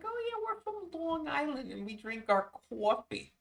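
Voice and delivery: high, far-off mock Long Island voice; Long Island girlfriend impression. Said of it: "It's like".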